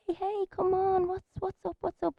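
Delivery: high, soft motherly coo; soothing mom. Retold or imitated. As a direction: coax a toddler out of a tantrum. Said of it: "and she's like".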